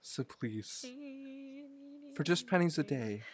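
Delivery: solemn animal-charity ad pitch; sad charity ad. Pretend. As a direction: plead like a charity commercial. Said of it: "my panicked rendition of [name]".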